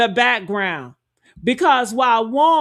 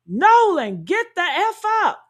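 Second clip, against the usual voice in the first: higher, half-raised mock yell; imitated yell; 7 semitones higher than usual. Someone rousing Nolan in the background, imitated yell. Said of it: "you can hear somebody yell".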